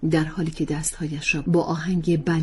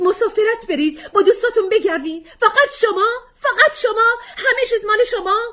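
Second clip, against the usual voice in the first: from sharp to shrill yelling, much higher and louder; building outburst; 12 semitones higher, 8 dB louder than usual. Acted. Wife erupting at husband at dinner, rising fury. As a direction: let it climb into a furious tirade.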